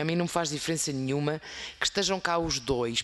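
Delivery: ordinary talk, nothing marked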